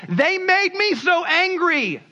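high, drawn-out accusing whine; blaming others. Acted. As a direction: wail it slowly, shifting the blame. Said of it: "you blame other people".

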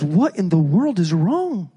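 slow, worried mother's question; lightly voiced anxious mom; alarmed mom. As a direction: ask it like a startled mother. Mother answering panicked daughter.

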